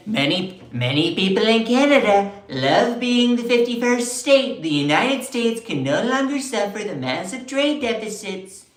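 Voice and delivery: pompous Trump imitation; mock Trump voice. Vlogger mocking Trump's posts, hammed-up Trump voice. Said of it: "And then".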